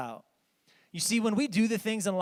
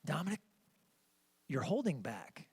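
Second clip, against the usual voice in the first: hushed coaching; 5 semitones lower, 10 dB quieter than usual. Retold or imitated. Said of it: "and I'm like".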